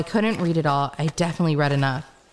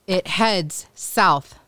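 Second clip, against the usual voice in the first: slow, separated words with weight on "heads" and "south"; "heads", "south", each set apart slowly and firmly; emphatic correction; much slower than usual. Acted. Woman correcting her guide's direction, slow and emphatic. Contradicting his claim of west.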